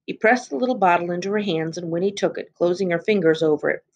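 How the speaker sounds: plain reading voice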